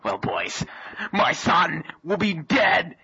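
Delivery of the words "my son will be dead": hammed-up, high-pitched desperate cry; desperate outburst. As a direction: build into a melodramatic plea. Father pleads for kidnapped son.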